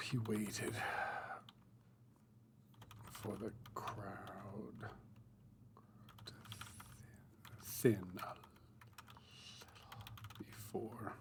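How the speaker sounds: whispered, slow reading of his own prose; soft draft reading. Acted. [name] reading his new draft aloud to viewers, whispered and slow. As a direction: read it under your breath, slowly, testing each line.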